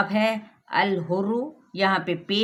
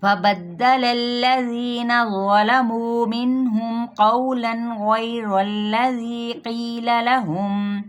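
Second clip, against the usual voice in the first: slow, louder, melodic tilawat; Quran recitation; 3 semitones higher, 4 dB louder, much slower than usual. Acted. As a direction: recite the verses slowly, chanting. (तिलावत के अंदाज़ में पढ़ना)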